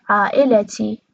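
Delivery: عائلتی as aa-ila-ti, vowel after the l; Qatari pronunciation. Shown as a demonstration. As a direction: put an a before ti in Qatari عائلتی.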